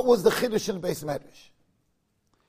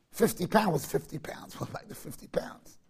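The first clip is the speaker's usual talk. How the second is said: Fifty pounds breathed out through laughter, trailing to a whisper; laughing whisper; 4 semitones lower, 9 dB quieter than usual. Real, not acted. Rabbi laughing at the overweight-suitcase joke, whispering. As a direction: laugh the words out softly.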